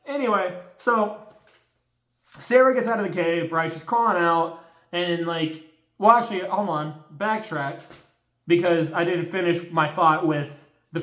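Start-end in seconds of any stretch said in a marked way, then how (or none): none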